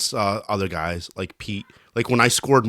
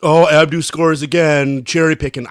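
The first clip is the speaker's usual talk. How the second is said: mock teasing; 6 semitones higher, 7 dB louder than usual. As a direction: mimic people needling him about cherry picking. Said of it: "I hate when people tell me".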